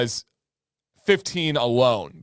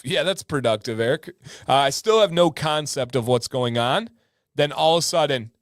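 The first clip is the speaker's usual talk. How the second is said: ordinary talk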